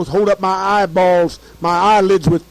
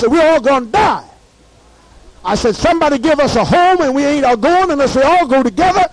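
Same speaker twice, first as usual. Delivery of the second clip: retold screaming; 7 semitones higher than usual. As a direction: cry out for rescue over the radio. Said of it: "screamed and screamed and screamed".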